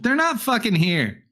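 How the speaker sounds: high-pitched report to companions; exasperated high-voiced henchman; irritated searcher. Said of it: "then he looks back out to the right".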